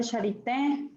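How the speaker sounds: Charité with the last syllable accented; Charité stressed on final é. Shown as a demonstration. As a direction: land Charité on the é. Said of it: "le puso acento a mi no". (Charité con acento en la é)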